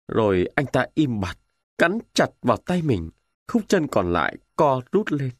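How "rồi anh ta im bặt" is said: ordinary delivery, nothing marked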